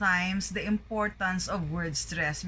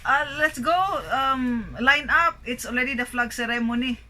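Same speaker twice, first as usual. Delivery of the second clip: higher, louder mock call to line up; hammed-up call to assemble; imitating people calling; 4 semitones higher, 4 dB louder than usual. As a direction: mimic someone rounding people up, pitched up. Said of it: "you hear people say".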